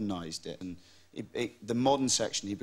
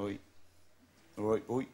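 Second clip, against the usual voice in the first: brief West Country greeting in Devon dialect; Devon accent; 3 semitones lower, 4 dB quieter than usual. Shown as a demonstration. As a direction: greet someone like a Devon local. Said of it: "do some of the accent".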